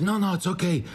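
high-pitched retold reassurance; acted phone reply, pitched well up; imitating Taras. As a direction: play down the danger over the phone. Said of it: "Taras is like".

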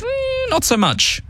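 high, slow, drawn-out scoff; dismissive. Acted. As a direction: wave it off mockingly. Dismissive aside on NZ First.

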